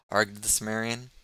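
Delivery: usual speaking voice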